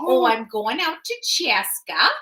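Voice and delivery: a light goofball Minnesota accent, long round vowels. Shown as a demonstration. Host showing off her Minnesota accent to viewers. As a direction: play up the Minnesota twang for fun. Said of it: "say 'em in the, uh, Minnesota sounding".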